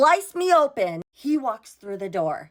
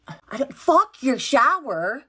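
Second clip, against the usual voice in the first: furious; 5 semitones higher, 3 dB louder, slower than usual. Retold or imitated. Furious outburst.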